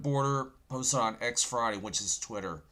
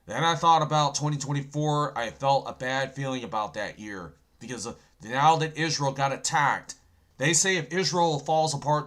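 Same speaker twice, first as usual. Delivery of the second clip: normal talking voice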